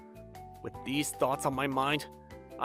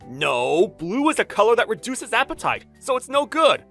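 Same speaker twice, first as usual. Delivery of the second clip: loud, raised-pitch protest; heated argument; 5 semitones higher, 7 dB louder than usual. Acted. Designer clashing with his boss over color, heated argument. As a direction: argue back hard and loud.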